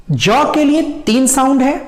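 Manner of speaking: ordinary talk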